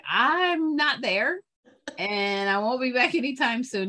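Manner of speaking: loud. Retold or imitated. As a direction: sing it out loud and high. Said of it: "I was like".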